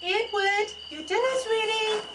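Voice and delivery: high, calling mother's voice; calling kids to dinner. Acted. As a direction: call him in from another room.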